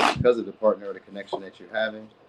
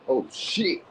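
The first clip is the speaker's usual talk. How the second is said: ordinary talk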